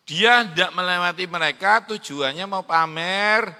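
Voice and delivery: loud, building preacher's voice; rousing sermon delivery; full-force preaching. Acted. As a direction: proclaim it to the hall.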